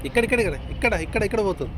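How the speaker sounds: high-pitched, urgent repetition; excited pointing out. Real, not acted. Pointing out a plane to child.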